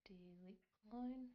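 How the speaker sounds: whispered to self. Real, not acted. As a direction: mutter it under your breath. Muttering to self at computer.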